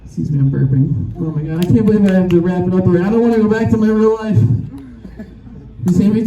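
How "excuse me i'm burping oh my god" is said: ordinary delivery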